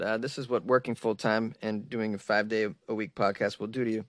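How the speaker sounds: usual speaking voice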